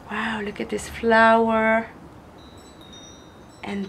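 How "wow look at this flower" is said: usual speaking voice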